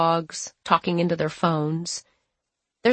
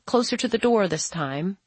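ordinary delivery

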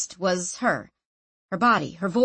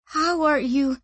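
higher, hesitant wondering; awed; 7 semitones higher than usual. A narrator's reading. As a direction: ask with a touch of awe.

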